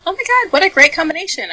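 high, excited gushing. Retold or imitated. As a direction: gush about it up high. Imitating enthusiastic girl.

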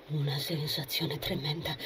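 hushed private thought; said to oneself. A narrator's reading. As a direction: think it aloud, softly. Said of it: "si disse".

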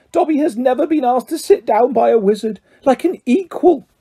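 high, overwhelmed little elf; choked elf. A narrator's reading. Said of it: "choked the elf".

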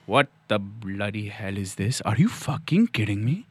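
put-on low, quiet, incredulous Indian accent. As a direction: Indian accent, simmering disbelief. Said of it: "He has not done an Indian accent".

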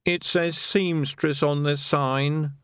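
put-on slow, halting old man's mumble; shy old man unsure of himself; old widower mumbling to Rosie. A narrator's reading. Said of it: "the old man mumbled".

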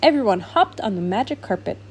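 ordinary delivery, nothing marked

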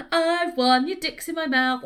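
high, loud mock gloat; petty gloating. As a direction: crow it like you've won a petty argument. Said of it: "I love the pettiness".

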